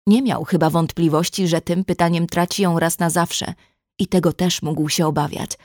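usual speaking voice

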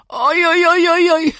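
loud, high howl of pain; man crushed by a door, howling in pain. A narrator's reading. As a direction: wail the oj's as if something just hit you. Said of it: "zawył Golum".

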